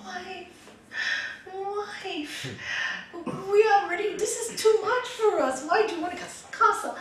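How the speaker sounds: high-pitched, pleading protest; storyteller's exasperated husband, voice way up; dismayed husband. Acted. Husband dismayed at castle demand.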